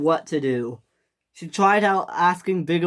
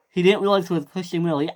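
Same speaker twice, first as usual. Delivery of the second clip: ordinary delivery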